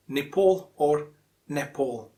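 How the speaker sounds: Nepal as ni-PAWL or ne-PAWL; two correct variants. Shown as a demonstration. Teacher repeating both accepted ways, two variants of Nepal. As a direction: say "Nepal" twice, changing only the first vowel. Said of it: "Two different ways".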